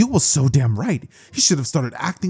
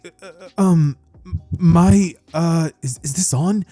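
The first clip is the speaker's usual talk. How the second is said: higher, halting stammer with fillers; nervous public speaker; 4 semitones higher, 4 dB louder than usual. Acted. Boy at the mic before a gigantic crowd, nervous. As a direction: stumble haltingly, then blurt it louder.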